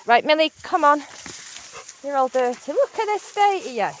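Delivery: high, sing-song scolding of a muddy dog; high dog-talk voice. Real, not acted. Owner to her muddy dog Millie, high fussing scold.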